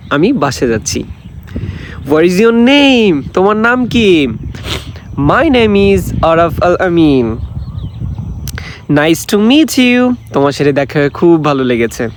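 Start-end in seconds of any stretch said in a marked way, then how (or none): 2.1-3.2 higher, very slow teacher read; slow model English. Shown as a demonstration. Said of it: "accent দিয়ে দিয়ে পড়বেন". 8.9-10.1 higher, very slow teacher read; slow model English. Shown as a demonstration. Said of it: "accent দিয়ে দিয়ে পড়বেন".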